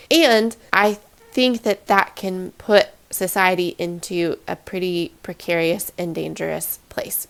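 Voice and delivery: ordinary talk, nothing marked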